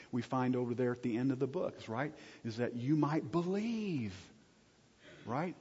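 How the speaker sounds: ordinary talk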